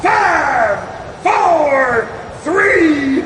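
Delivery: wild countdown shout. Acted. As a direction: count it down in giddy excitement. Countdown riding the bomb.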